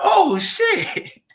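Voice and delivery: very high, falling "oh shit"; surprised squeal. Retold or imitated. Streamer reacting in shock to strangers knowing Kwame, high squeal.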